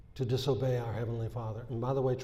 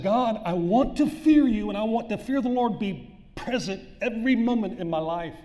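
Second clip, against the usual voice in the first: fervent prayer; 9 semitones higher, 7 dB louder than usual. Retold or imitated. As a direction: cry out to God with all your heart. Retold cry out to God.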